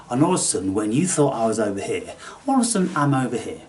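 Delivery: quick, playful switch; sudden shift. Shown as a demonstration. Showing viewers how he jumps about, quick and teasing. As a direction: dart from one spot to another. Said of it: "speaking in a very different way".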